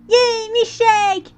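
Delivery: gleeful colt yell; joyful cheer. Acted. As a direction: cheer like a delighted kid. Button exclaims.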